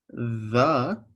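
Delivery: the th held with the voice buzzing, as in "the"; voiced th sound. Shown as a demonstration. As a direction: let "the" ring with voiced th. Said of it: "to compare this with other letters".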